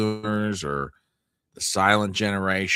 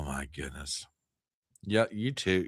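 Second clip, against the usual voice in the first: ordinary talk, nothing marked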